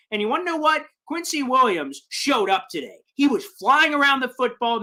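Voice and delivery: ordinary talk, nothing marked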